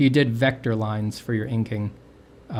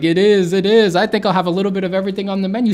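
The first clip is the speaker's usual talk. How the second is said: smug patron; 9 semitones higher, 8 dB louder, faster than usual. Retold or imitated. Self as pompous patron.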